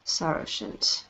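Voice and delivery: breathless whisper of the name; hushed whisper. Acted. Matron echoing his secret name in a whisper. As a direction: let the name slip out under your breath.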